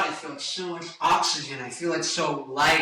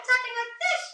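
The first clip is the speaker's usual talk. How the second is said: much higher falsetto speaking. Shown as a demonstration. Singer shows falsetto speaking voice. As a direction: talk up in your falsetto. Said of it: "your falsetto up here".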